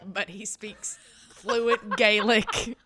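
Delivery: high, gushing fangirl swoon. Real, not acted. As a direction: swoon like a lovesick fan. Swooning over Jamie.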